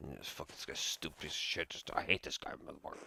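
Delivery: low, soft muttered curses at the Baron. Acted. Player curses the rude Baron under his breath, low muttering. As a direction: grumble the insults under your breath.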